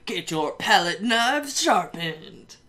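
booming rising Sunday chant; monster truck ad. Pretend. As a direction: hype it like a stadium promo.

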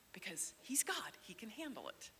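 hushed, soft whisper. Real, not acted. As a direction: drop to a whisper. Softly dropped voice.

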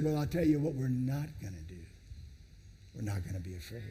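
lightly voiced, low and calm prophet; calm Elisha. Elisha reassuring his frightened servant, calm and low. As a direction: settle him down quietly. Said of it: "Elisha answered and he said".

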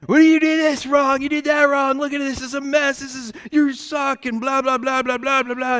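high, loud mock tirade; imitating yelling supervisor. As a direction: rant like an angry boss. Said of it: "he would come over and yell at me".

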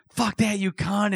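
high, hammed-up yelled insult; mock shouting. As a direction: yell it like you're being dragged out. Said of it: "and I'm shouting".

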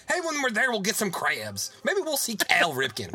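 hammed-up Baltimore local making plans; mock Baltimore accent. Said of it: "they say it with that stupid fucking accent".

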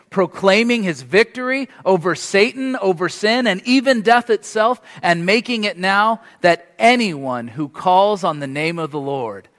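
loud, high, fervent proclamation; preaching at full force. Acted. Preacher proclaiming the unstoppable gospel to Cornerstone, loud and fervent. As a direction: shout the victory to the whole church.

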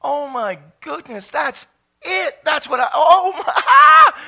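acted awe building to a high, loud wail; overcome by revelation. Preacher re-enacting his moment of revelation, overcome. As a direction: gasp it out, climbing toward a mock-tearful cry. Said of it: "you start to cry".

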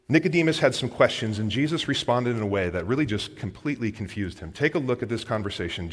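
normal talking voice

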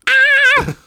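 sudden high yell. Pretend. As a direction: shout it out high and loud. Joke song ends in yell.

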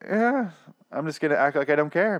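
high, sing-song fake indifference; a parent who pretends not to care but is seething; parent faking calm. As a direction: parent hiding anger from a kid, in mock indifference. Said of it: "all of our parents have been there".

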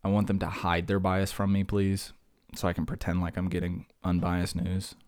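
ordinary delivery

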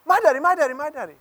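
put-on two little boys fighting over daddy, squealing; kids squabbling. Pastor imitating his sons fighting over his lap.

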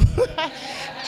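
short high unvoiced laugh; a quick real laugh; breathy chuckle. Real, not acted. Laughs at own joke.

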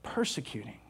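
voice of Jesus. Acted. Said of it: "he goes on, he says".

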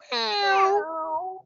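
high, squeaky cat meow; kitten voice. Shown as a demonstration. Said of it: "I do my best cat impression".

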